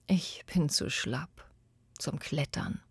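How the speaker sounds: soft, voiceless, worn out; an old man with no strength left; tired old whisper. Acted. Old boy tells the tree he is too old, whispering.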